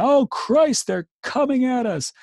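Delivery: screamed very high and loud; panicked cry. Acted. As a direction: shriek it in terror. Soldier panics at attack.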